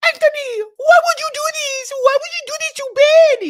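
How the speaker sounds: high, loud mock wail; imitation of a wife's shrill protest; overdramatic upset wife. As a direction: shriek it in hammed-up distress.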